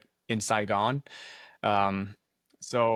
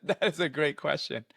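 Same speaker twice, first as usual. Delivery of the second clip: amused, a laugh through the words; tickled by the question; 3 semitones higher than usual. Real, not acted. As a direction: chuckle your way through it. Laughs at host's playful question.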